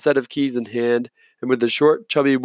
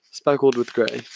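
plain reading voice

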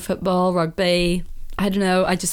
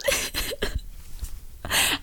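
breathy giggle; 8 dB quieter than usual. Real, not acted. Laughing, can't finish rating.

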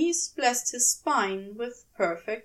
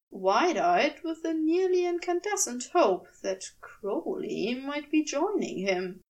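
ordinary delivery, nothing marked

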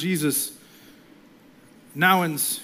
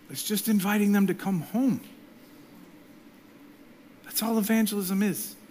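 usual speaking voice

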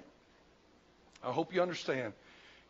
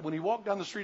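normal talking voice

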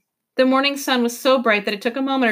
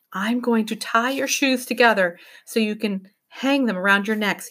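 ordinary delivery, nothing marked

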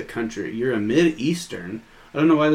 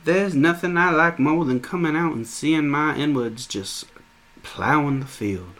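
Southern drawl, pitched up; mock Southern accent; 4 semitones higher than usual. Joining in the skit as a Southern landowner, mock Southern accent. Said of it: "the Southern accent kinda developed as a class structure".

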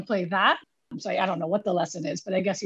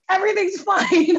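forced cheer; 11 semitones higher, 6 dB louder than usual. Retold or imitated. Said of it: "I was like".

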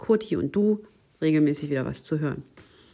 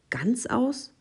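astonished cry; 8 semitones higher than usual. A narrator's reading. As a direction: echo "Ganzaus" high in disbelief. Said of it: "rief die Maus". ("Ganzaus" hoch und ungläubig nachgefragt)

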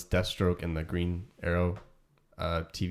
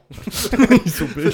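put-on slow, drawn-out kid voice, higher and louder, through a laugh; awed little kid; 7 semitones higher, 7 dB louder, much slower than usual. A kid marvelling at the huge Kingpin on screen, slow and awed. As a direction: stretch it out like a wide-eyed child. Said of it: "Adelaide would just go".

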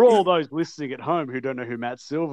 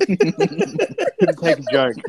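ordinary talk, nothing marked

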